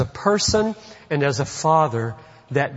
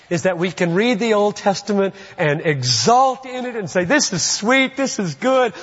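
high, driving, exultant sermon delivery; preaching at full force; 7 semitones higher than usual. Real, not acted. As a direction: build an unbroken, emphatic proclamation of good news.

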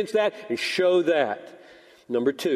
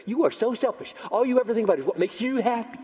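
high-pitched mock complaint; higher, rushing into a scolding; accusing wife; 7 semitones higher, faster than usual. Imagined wife scolding husband for selfishness, mock accusing.

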